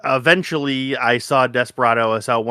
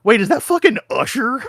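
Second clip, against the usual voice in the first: high, louder, thrilled double take; excited disbelief; 9 semitones higher, 4 dB louder than usual. Retold or imitated. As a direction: shout it like you just spotted a celebrity. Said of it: "and I go".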